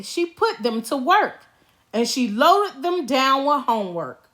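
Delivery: usual speaking voice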